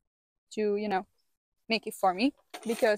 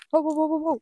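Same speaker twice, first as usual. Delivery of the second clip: higher, louder startled whoas; real alarmed whoa; 6 semitones higher, 5 dB louder, faster than usual. Real, not acted. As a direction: react to a sudden flare. Fire flares again.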